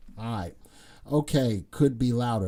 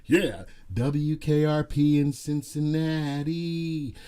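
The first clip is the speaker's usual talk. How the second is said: slow radio DJ announcer; disc jockey; slower than usual. Pretend. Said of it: "I feel like a disc jockey".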